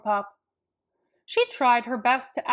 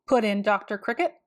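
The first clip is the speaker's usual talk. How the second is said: ordinary delivery, nothing marked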